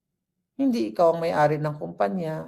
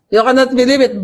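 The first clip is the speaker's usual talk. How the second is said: loud, high mock British accent; 5 semitones higher, 11 dB louder than usual. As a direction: put on an exaggerated British accent, amused. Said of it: "British accent". (pabirong British accent, malakas)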